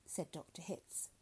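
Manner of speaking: ordinary delivery, nothing marked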